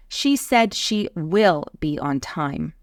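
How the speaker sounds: emphatic, full "will"; stress on WILL. Shown as a demonstration. Teacher showing certainty, strong stress on "will". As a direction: lean firmly on "will". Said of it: "we are creating extra emphasis".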